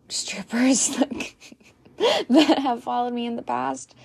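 giggling through the words; laughing. Real, not acted. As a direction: laugh it out, half whispered. Laughs at own word.

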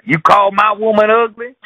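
high loud hothead picking a fight; hothead boyfriend. Retold or imitated. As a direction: bark it at a stranger. Boyfriend challenging a bystander.

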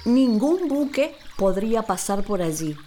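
ordinary delivery

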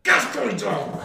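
high, giggly put-on voice; laughing imitation. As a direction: squeak it through a laugh. Voicing teammate, laughing.

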